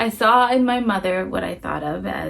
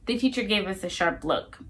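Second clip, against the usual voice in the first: usual speaking voice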